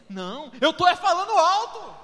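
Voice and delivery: mock protest, half-shouted; loud self-defense. Pastor replaying his own reply to his wife, loud. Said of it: "E eu brigando com você?".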